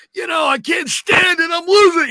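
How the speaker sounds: high, loud, near-crying rant; anguished fan caller. Retold or imitated. Fan calling the show, wailing that his team is losing. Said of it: "someone who's gonna call up and be like".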